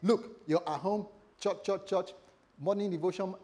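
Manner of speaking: ordinary talk, nothing marked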